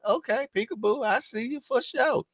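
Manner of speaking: high, playful sing-song; peekaboo voice. Pretend. Streamer teasing as if playing peekaboo, high-voiced. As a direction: pipe it up as in a game of peekaboo. Trying to play a little peekaboo.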